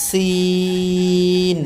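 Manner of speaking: Seen held very long on a level tone; three-alif stretch. Shown as a demonstration. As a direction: draw out the vowel of সিন for three counts. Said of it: "উচ্চারণের দিক থেকে খেয়াল করতে হবে".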